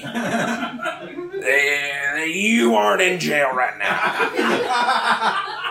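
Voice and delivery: put-on high, slow Southern drawl of an old grandpa, laughing through it; Southern grandpa impression. Grandson imitates his grandfather's pleading Southern drawl on the phone. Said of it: "very deep Southern drawl and he is very gravelly".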